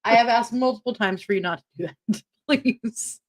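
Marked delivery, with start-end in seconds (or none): none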